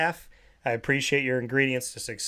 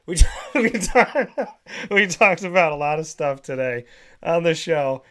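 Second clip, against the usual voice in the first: laughing; 3 semitones higher, 5 dB louder than usual. Real, not acted. Laughing while recapping.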